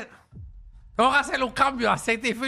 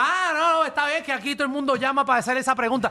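higher, quick mimicked reply; imitated shop employee; 5 semitones higher than usual. Employee excusing himself to the customer, mimicked high and quick. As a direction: brush it off fast in a raised voice. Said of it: "Y me dice".

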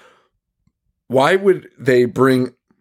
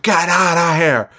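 higher, drawn-out mock outburst; mock temper tantrum; 6 semitones higher than usual. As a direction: imitate mom throwing a fit. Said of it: "more temper tantrumy".

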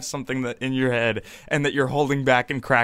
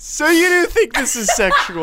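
laughing; 7 dB louder than usual. Real, not acted. Laughing incredulous.

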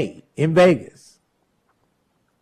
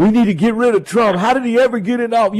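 higher, fast mock complaint; mocking whiny caller; 6 semitones higher, faster than usual. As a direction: sneer it out in a whiny parody of the caller. Mocking anti-Trump callers.

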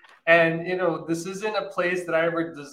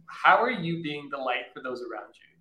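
normal talking voice

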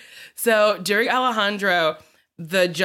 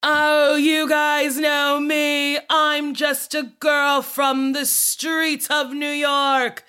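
slow, higher, earnest Gaga speech; [name] impression; 6 semitones higher, much slower than usual. A lightly voiced Gaga, slow and higher, talking to her concert crowd. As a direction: drawl it like a pop star confiding to fans. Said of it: "then [name] was like".

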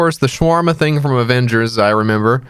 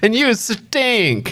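high slow mock jeer; childish taunt; 11 semitones higher, much slower than usual. Friend adds to the villain mockery, high slow childish jeer. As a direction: drag it out like a kid teasing.

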